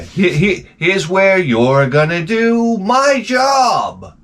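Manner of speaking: high, slow, laughing mockery; lampooning the self-checkout clerk, mocking. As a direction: sneer at the clerk's instructions. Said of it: "she directs you".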